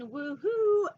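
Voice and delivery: slow, loud, high whoop; ambush whoop. Acted. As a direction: stretch the woohoo out with glee. Shark ambush cheer.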